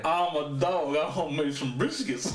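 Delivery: goofy dog voice breaking into laughter; talking dog voice. Acted. Listener mocking how dogs talk, goofy dog voice. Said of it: "is that how dogs talk".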